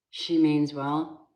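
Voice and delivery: ordinary talk, nothing marked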